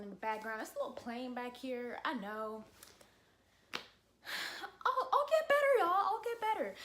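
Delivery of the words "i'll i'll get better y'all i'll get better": high-pitched, loud burst easing off; playfully over-the-top pleading, pitched way up; mock pleading. Vlogger promising viewers a better background, mock pleading.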